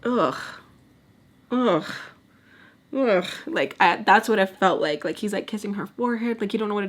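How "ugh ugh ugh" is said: imitated "ugh" of disgust; grossed-out groan. Imitating her being kissed on the forehead, disgusted groans. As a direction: groan as if revolted by a kiss. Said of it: "like, grossed out".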